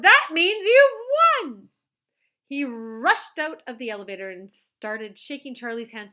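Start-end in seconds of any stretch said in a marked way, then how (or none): 0.0-1.7 high, loud, slow delighted cry; cried out. A narrator's reading. Wonka crying out to Charlie that he won. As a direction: shout the news with glee. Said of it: "he cried out".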